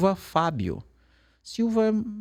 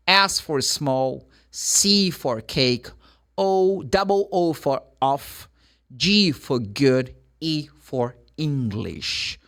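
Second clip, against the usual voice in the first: slow, careful letter-and-word spelling; deliberate spelling for a learner; slower than usual. Shown as a demonstration. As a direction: give each letter slowly with its example word. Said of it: "spell the word again slowly".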